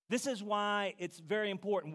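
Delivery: normal talking voice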